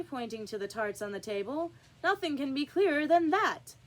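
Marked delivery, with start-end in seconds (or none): 2.0-3.8 higher and louder, sure of himself; triumphant king. Acted. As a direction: crow it as final proof. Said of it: "said the king triumphantly".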